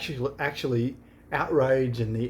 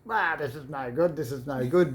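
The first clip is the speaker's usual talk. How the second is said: grandpa voice; 2 semitones higher than usual. Retold or imitated. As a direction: grumble it like a cranky grandpa. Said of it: "You do that grandpa voice very well".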